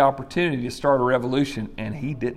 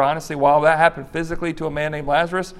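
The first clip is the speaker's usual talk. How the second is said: ordinary delivery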